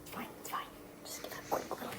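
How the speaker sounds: whispered resigned aside; whispering. Real, not acted. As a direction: whisper it, giving up. Resigned mutter over mess.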